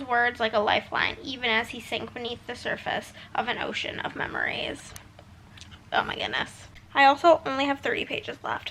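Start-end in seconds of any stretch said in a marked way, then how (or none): 5.9-6.7 breathy hushed whisper. Real, not acted. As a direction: whisper it, overcome. Overwhelmed hushed reaction.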